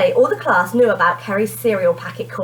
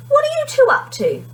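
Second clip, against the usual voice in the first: curious mum. A narrator's reading. Mum's curious question.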